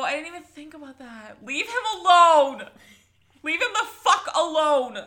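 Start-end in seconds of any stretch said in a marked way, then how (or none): 1.4-2.6 slow, loud protective yell. Real, not acted. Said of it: "My yelling is echoing". 3.4-5.0 loud yell breaking into laughter. Real, not acted. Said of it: "My yelling is echoing".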